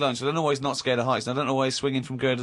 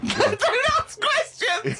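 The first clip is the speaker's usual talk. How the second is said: incredulous laughing; much slower than usual. Real, not acted. Repeating the line in disbelief.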